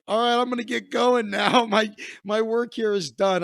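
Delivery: loud, pleased-with-himself send-off; smug mock exit. Storyteller leaving the film set he derailed, loud mock pride. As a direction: announce your grand exit like a job well done.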